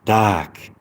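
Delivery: "Dark" said as dahk, R dropped; British dark. Shown as a demonstration. Showing listeners how to say British "Dark", no R. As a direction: relax into an open "Dark" without the R. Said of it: "But you say it like this".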